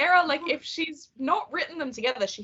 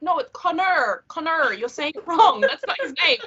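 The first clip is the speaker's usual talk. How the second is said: American correction; 6 semitones higher, 3 dB louder than usual. Retold or imitated. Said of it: "And they're like".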